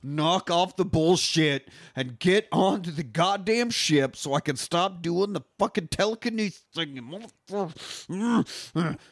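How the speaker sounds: strained high voice, slowing on the final curse; Cosmo. Retold or imitated. Co-host voices Cosmo straining to hold the seal, exasperated high voice. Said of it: "she's like".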